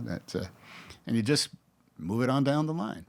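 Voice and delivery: normal talking voice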